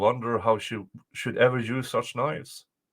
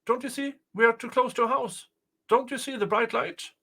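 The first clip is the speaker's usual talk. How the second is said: put-on high-pitched, urgent boy pointing out the light; eager insistence; 10 semitones higher than usual. Boy argues with mother.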